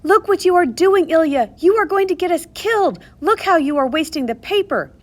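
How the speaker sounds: high, loud panicked scolding; frantic scolding yell. A narrator's reading. Rivka yelling at Ilya to stop, frantic. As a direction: yell at him to stop, scared. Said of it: "I yelled for him to stop".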